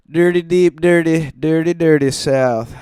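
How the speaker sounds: loud high hammed-up Southern chant; southern accent shout. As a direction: belt it out like a proud Southern boy. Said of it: "in a southern accent".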